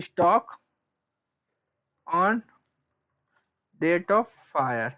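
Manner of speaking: drawn-out words with long gaps; slow dictation. Real, not acted. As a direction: give it out word by word. Dictates formula while writing it.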